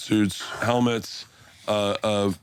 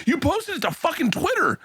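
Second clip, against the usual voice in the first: mock Joe; 11 semitones higher than usual. Bert retells Joe quoting fans.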